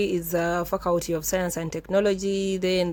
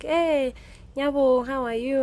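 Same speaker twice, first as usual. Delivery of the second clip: gentleman greeting; 6 semitones higher than usual. Retold or imitated. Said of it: "He's like".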